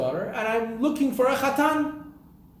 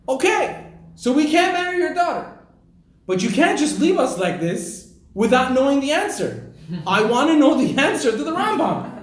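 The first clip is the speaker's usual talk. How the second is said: higher, louder, pressing for the answer; insistent demand; 6 semitones higher, 6 dB louder than usual. Acted. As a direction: urge him not to leave them hanging, breaking into a laugh.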